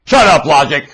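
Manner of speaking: loud shouted command; mock yell. Preacher voicing sellers yelling at logic, a loud shout. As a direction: yell it like they do. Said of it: "That's what they're yelling".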